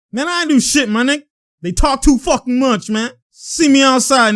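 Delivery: high-pitched mock protest and challenge; imitated trash talk. Imitating players trading threats, high heated protest. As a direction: protest high, then call him out. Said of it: "that's what he's saying".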